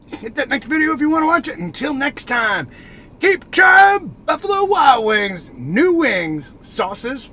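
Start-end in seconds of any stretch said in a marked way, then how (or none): 3.2-5.5 loud, high, slow drawn-out catchphrase; announcer sign-off. Acted. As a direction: belt out the sign-off like a host.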